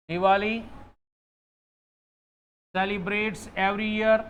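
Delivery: "Diwali celebrates every year" said very slowly, word by word; slow wrong example. Shown as a demonstration. Teacher slowly reads the wrong sentence to students. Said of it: "बताओ कौन सा sentence सही है?".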